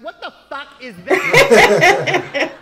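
loud, slow, horrified shout; shocked shout. Retold or imitated. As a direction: yell it in shock. Girl shocked by machine.

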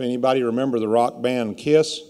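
ordinary talk, nothing marked

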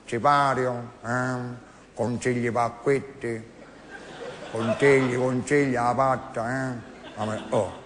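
slow mumbling Costanzo impression. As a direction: mumble it lazily like an old talk-show host. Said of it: "Sempre dicendo".

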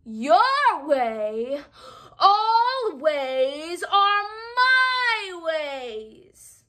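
high, loud, slow furious queen; Queen of Hearts raging at the gardeners; yelling Queen of Hearts. Acted. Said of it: "yelled the queen".

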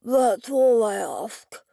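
slow words through a mouthful; grieving husband mumbling while chewing, mouth full. A narrator's reading. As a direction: talk around a bite of meat. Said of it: "spoke with a full mouth".

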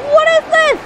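high astonished cries. Real, not acted. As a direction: gasp at the madness. Astonished at chaos.

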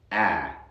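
firm "A" midway between ah and eh; in-between vowel. Shown as a demonstration. Teacher shows the English vowel between two, firm cat-vowel "A". Algo entre esos dos sonidos.

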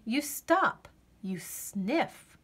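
ordinary delivery, nothing marked

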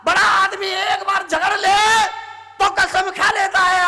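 high, forceful preacher's shout; ringing high proclamation; loud declaiming. Shown as a demonstration. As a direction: raise the voice to the crowd. Preacher declaims about grown-ups quarrelling.

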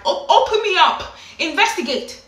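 high, loud, comic desperation; hammed-up exasperated outburst; exasperated plea. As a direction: demand to be examined, at wits' end.

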